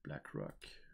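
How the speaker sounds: very soft, voiceless murmur; whispered to self. Real, not acted. Co-host searching for the monument, whispering to self. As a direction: breathe the name while searching.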